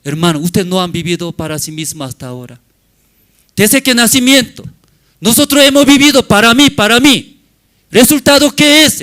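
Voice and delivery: loud, high, fast preaching to the brothers; shouted sermon climax; fiery preaching. Acted. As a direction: drive it home from the pulpit. Preaching at full force.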